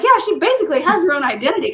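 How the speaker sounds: high, loud, fast gush; playful excited reply. Retold or imitated. Answering the organizers about her seat holder, playfully excited.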